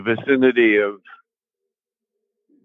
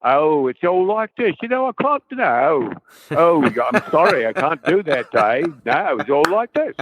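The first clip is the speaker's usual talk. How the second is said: higher, lively Cockney imitation; chirpy Cockney impression, pitched up; Cockney Hal voice; 5 semitones higher than usual. Assistant director reading the computer's lines for the actors, in a higher Cockney accent. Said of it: "the voice of Hal was something like this".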